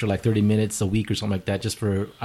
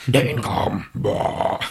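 metal guy; much slower than usual. Pretend. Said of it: "where's the Satan voice".

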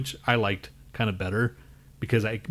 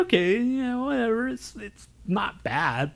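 much higher, put-on sneering shrug of a voice; mock dismissive voice; 13 semitones higher than usual. Mocking the band heard on the radio, high sneering voice. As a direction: squeak out a bored, dismissive whatever. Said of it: "It's like".

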